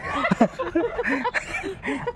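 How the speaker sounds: very high squealing giggle; a high, shrieking giggle at the joke; high squealing laugh. Real, not acted.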